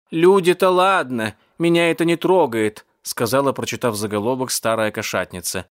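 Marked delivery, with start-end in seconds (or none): 0.1-2.9 put-on higher, louder old cat lady, unbothered; old cat lady, dismissive. Old cat lady reacts to the headline, dismissive.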